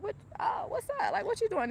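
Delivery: high startled what's up to a gunman; startled greeting. Retold or imitated. Telling of a home invasion, confused high greeting.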